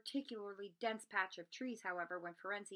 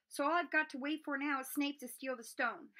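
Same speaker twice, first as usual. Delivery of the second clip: feverish; 6 semitones higher, 4 dB louder than usual. Acted. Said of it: "Harry went on feverishly".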